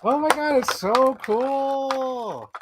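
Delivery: real awestruck gush, stretched long; gushing excitement. Real, not acted. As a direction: swoon over the figure. Gushing over a Power Girl figure.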